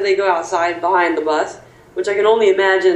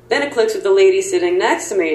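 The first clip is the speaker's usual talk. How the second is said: ordinary talk, nothing marked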